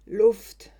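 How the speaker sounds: nach Luft ringend, laut und hoch herausgepresst; stoß das Wort keuchend heraus, der Hals abgedrückt. A narrator's reading. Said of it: "keuche ich". (gewürgt von der Alten auf dem Rücken, keuchend nach Luft)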